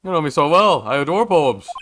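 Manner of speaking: high, loud French flourish; gushing French character. Acted. French restaurateur delighted by a poem, gushing. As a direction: swoon over poems in French accent.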